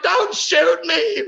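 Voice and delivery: high, slow plea broken by laughter; laughing mock plea. Retelling his panic at the gun, a high laughing mock plea. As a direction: beg for your life while cracking up. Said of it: "I'm like".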